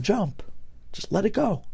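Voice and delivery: soft, high-pitched voice in the head; hushed, high imitation of the inner tempter; tempting inner voice. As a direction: coax quietly, lifted high, like an intrusive thought. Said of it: "I actually heard the voice".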